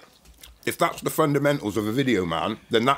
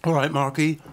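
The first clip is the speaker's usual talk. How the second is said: higher-pitched imitated greeting; woman at the door; 6 semitones higher than usual. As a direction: voice a friend's partner answering the door. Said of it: "Shani opened the door".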